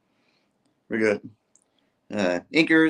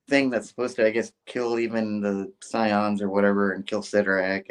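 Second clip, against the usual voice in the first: ordinary talk, nothing marked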